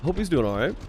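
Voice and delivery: usual speaking voice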